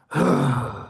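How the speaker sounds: a letting-go sigh like the client's; sigh like hers. Shown as a demonstration. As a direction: copy her release sigh. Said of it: "And here's this one".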